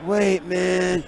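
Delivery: stoned wonder. Retold or imitated. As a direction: marvel at it like someone very high. Acts himself stoned, marvelling at hawk.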